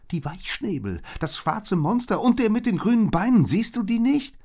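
high, loud, alarmed cry; frantic warning. A narrator's reading. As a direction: warn urgently of approaching danger. Bird frantically warns friend of monsters. (aufgeregt und schrill warnend)

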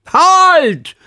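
lauter, sehr hoher gedehnter Ruf; rufen. A narrator's reading. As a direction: stopp die durcheinanderlaufende Klasse mit einem Aufschrei. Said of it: "rief er kurz darauf". (lautes Kommando)

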